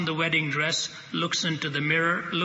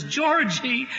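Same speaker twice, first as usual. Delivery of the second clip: joyful surprise; 7 semitones higher than usual. Retold or imitated. Mary turns, sees George.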